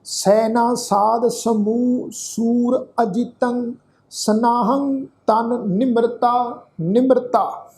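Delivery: slow, measured Gurbani recitation; scripture recitation. Shown as a demonstration. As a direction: draw out each word of the verse, evenly paced. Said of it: "ਇਸ ਦਾ ਪਾਠ ਰਲ ਮਿਲ ਕੇ ਉਚਾਰਨ". (ਹੌਲੀ, ਠਹਿਰ ਕੇ ਗੁਰਬਾਣੀ ਪਾਠ)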